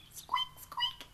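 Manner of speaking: very high squeaks; mouse squeak. A narrator's reading. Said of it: "it went".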